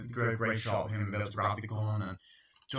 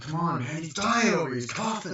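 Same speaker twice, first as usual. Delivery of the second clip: much higher and louder, frantic; shouted plea; 7 semitones higher, 4 dB louder than usual. Retold or imitated. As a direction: yell for help from the back of the bus. Background actors calling for help.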